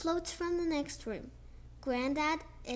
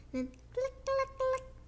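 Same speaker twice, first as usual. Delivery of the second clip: click, click climbing much higher; clicking sound effect; 10 semitones higher than usual. A narrator's reading. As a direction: play the dominoes clicking.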